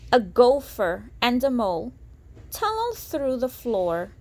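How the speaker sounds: lilting storybook read-aloud; sing-song rhyming narration; reading to children. A narrator's reading. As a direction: read it like a bedtime picture book. Reading picture book aloud.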